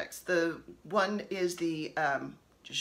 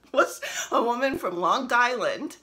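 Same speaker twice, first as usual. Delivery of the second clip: lightly put-on Long Island accent, louder; mock Long Island accent; 5 semitones higher, 6 dB louder than usual. Telling the Beatlemania story in a mock Long Island accent. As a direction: poke fun at a Long Island twang. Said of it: "I'm making fun of a Long Island accent".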